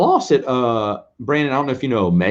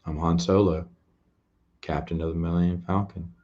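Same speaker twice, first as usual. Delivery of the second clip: a lightly voiced Han Solo, low-key and a bit soft; Han Solo impression; 4 semitones lower, 7 dB quieter than usual. Streamer shows chat his Han Solo impression, lightly voiced. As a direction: play a cocky space captain, barely changed from his own voice. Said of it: "Here's my Han impression".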